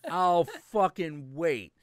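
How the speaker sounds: loud, drawn-out dare; defiant challenge. Real, not acted. Host daring listeners to name a superhero, loud slow dare. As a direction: dare them to name one. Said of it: "challenging the listener".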